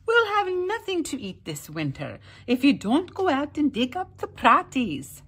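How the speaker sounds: high, loud Irish wife scolding; nagging wife. A narrator's reading. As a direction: nag him to get out and dig. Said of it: "his wife Eileen would say".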